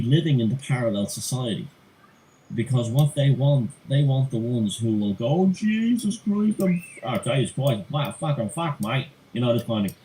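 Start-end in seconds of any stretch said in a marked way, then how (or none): 5.3-9.1 put-on over-the-top Australian accent, pitched up, drawled at the start; a hammed-up Aussie exclamation. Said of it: "it's Australian accent".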